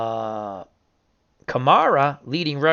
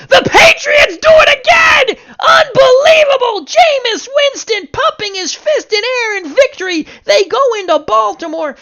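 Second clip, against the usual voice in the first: much higher and louder screaming, nearly crying with joy; ecstatic call; 8 dB louder than usual. Acted. Announcer calling Patriots' Super Bowl berth, ecstatic screaming. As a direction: shout it like the greatest win ever.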